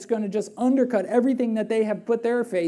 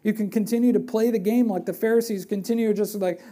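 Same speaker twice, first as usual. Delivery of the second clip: ordinary delivery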